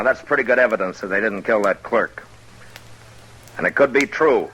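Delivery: addressing a crowd. Acted. Marshal addressing the mob.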